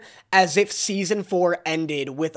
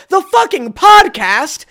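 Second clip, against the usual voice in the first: much higher, louder and slow, outraged disbelief; offended co-host fuming at a snub; indignant; 11 semitones higher, 9 dB louder, slower than usual. Retold or imitated. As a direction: fume at the insult. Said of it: "I'm like".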